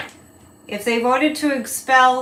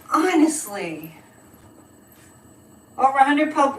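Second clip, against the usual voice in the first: ordinary delivery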